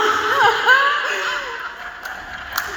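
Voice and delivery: high, loud burst of laughter; big laugh. Real, not acted. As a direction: laugh hard at yourself. Laughing at her past self.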